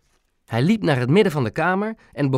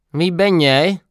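louder, higher gruff demand through the nose; gruff, nasal; 4 semitones higher, 5 dB louder, slower than usual. A narrator's reading. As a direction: bark it through your nose. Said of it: "vroeg hij bars met een echte neusklank". (bars, met een echte neusklank)

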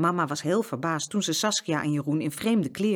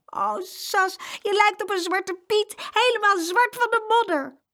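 much higher boy's voice, mocking; teasing boy. Jeroen mocks his muddy sister, high teasing voice. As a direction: tease your sister gleefully. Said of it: "kwam niet meer bij van het lachen".

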